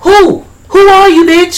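high, loud, scoffing demand; indignant outrage. Real, not acted. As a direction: challenge him, outraged and loud. Indignant retort to cops.

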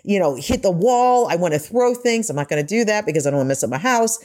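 ordinary talk

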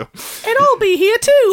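put-on British baby voice. Baby voice continues.

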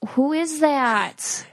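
drawn-out retold double take; who-on-earth amazement, drawn out; stunned disbelief. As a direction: stretch it out slowly, incredulous.

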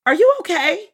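high, loud, sugary helpfulness with an edge; fake customer-service sweetness. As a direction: ask like a sarcastic shop clerk. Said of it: "Or my favorite".

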